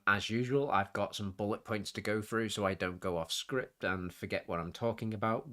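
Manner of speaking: ordinary talk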